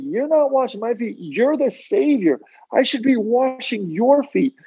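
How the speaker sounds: sincere, high-pitched apostle's plea; Peter's earnest protest. Retold or imitated. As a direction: plead like Peter refusing the Savior. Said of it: "in all the sincerity and humility that you can imagine".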